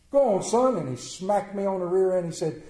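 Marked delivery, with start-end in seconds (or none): 0.1-0.8 high, loud, cheerful push; hearty send-off. Retold or imitated. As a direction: send the boy off with a hearty shove. Preacher sending boy off kindly.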